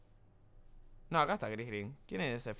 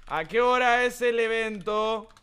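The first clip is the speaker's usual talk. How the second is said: louder, slow, drawn-out yell; mock shout; 5 semitones higher, 5 dB louder, slower than usual. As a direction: shout it like caps-lock text. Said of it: "Grito porque lo puse en mayúscula".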